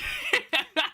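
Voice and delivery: a short high laugh; amused laugh. Real, not acted. Laughs at a huge painting.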